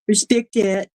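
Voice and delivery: ordinary talk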